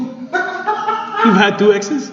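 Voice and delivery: amused disbelief. Acted. GM mock disbelief, laughing.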